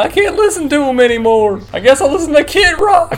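high joking dismay, laughing; mock dismay. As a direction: play it as fake outrage. Mock despair joke.

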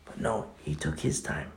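breathy hush; soft whispered aside. Real, not acted. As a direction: drop to a whisper for the turn. Hushed turn.

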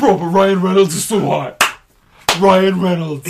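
loud, sputtery gushing voice; Neri impression. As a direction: gush with a spitty lisp. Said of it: "like a Cuban Porky Pig".

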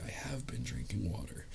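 a tiny unvoiced whisper. Recruit whispering to his buddy, hushed whisper. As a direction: breathe it out so the sergeant can't hear. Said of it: "just whispers, like, real quiet".

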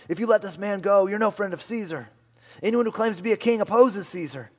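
loud, high mock shout; shouting crowd. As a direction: hurl it like an angry mob. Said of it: "kept shouting".

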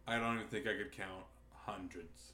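low, quiet, weary deadpan; dry deadpan. Retold or imitated. Shop guy answering about the wand joke, dry deadpan. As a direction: drop it low and dry, barely bothering. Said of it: "Just dry and like".

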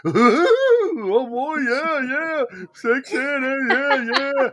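high, giddy mock teenage boy voice; goofy teen boy squeal. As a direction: play a snickering teen boy. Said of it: "we're all acting like, you know, teenage boys".

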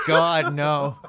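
ordinary talk, nothing marked

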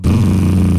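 loud, low buzzing noise; imitated bee buzzing. Mimicking the bee bin for friends, loud buzz. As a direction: buzz loudly like angry bees in a bin. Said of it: "the bee bin go".

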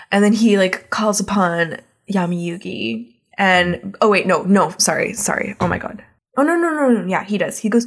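ordinary talk

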